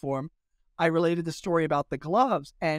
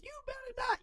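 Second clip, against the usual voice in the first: very high, soft falsetto imitation of a scolding character; Medea voice; 11 dB quieter, faster than usual. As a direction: squeak out a mock warning in falsetto. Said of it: "launches into the Medea voice".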